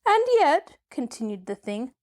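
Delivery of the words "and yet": high squeaky creature voice; tiny squeak from a huge beast; squeaky gump voice. A narrator's reading. Newly awakened gump marvelling at itself, squeaky voice.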